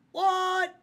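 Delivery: high squeaky what; surprised squeal. Pretend. Mock surprised outburst.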